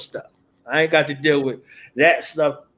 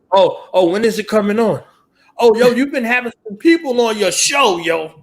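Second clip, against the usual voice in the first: higher, louder eager fan voice; imitating fans; 7 semitones higher, 4 dB louder than usual. Host mimics fans nagging him about his show, eager voice. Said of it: "this is how they talk to me".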